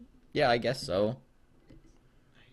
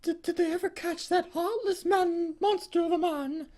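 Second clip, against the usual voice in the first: hammed-up grief; 12 semitones higher than usual. Betty asks the employee about the killer, high mock-tragic wail. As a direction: wail it like a soap-opera mother.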